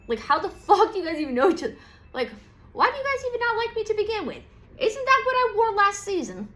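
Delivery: high, loud and fast outraged rant. Retold or imitated. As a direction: rant like a diva whose look was copied. Scorpio ranting at the ants.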